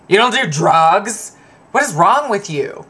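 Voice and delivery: mock shock at a non-drinker; incredulous partygoers. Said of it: "people are always like".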